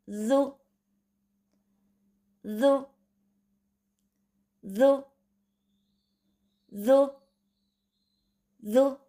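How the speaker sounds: जू with the tongue tip on the upper front teeth, repeated as a drill. Shown as a demonstration. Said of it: "फिर जू के मखरज को अदा करें".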